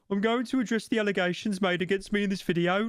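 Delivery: high-pitched mock Brummie accent; Birmingham accent. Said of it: "like, a Birmingham accent".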